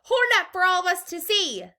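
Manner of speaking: high, loud crowd voice; calling out. A narrator's reading. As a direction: demand it over heads.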